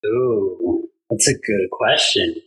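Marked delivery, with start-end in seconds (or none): none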